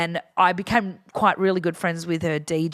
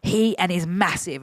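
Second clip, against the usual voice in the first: ordinary delivery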